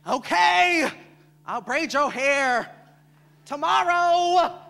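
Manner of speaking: high, loud, stretched-out words; sassy girlfriend. Acted. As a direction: draw it out playfully to a girlfriend. Girlfriend voice acted in poem.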